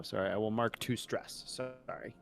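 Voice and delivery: ordinary delivery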